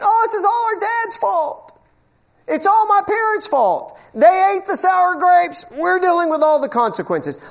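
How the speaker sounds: high, whiny mock of complainers; mock them whining about their parents; mocking whine. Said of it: "They're saying".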